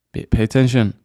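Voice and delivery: usual speaking voice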